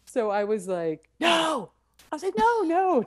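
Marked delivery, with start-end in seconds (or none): 1.2-1.7 sharp high no; a quick high-pitched no; emphatic no. Retold or imitated. Denying they are sisters. 2.4-3.1 high rapid nos; a flustered no, no; frantic no. Retold or imitated. Denying they are sisters.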